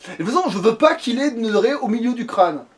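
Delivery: ordinary talk, nothing marked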